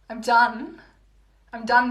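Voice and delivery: playful loud give-up; exasperated exclaim. Real, not acted. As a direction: loudly throw up your hands at chat. Trying to end the stream.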